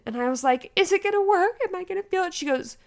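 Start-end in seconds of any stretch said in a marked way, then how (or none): 0.8-2.3 high, urgent pleading question, acted; retold desperation. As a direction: beg the nurse for reassurance, high-pitched.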